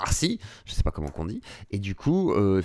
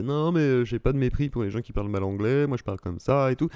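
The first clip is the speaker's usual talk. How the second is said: put-on defensive voice, slightly raised; imitating the video maker. Reactor imitating the video maker's excuses, lightly mocking impression. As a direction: mimic his self-justifying speech. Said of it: "en gros c'est comme ça qu'il parle".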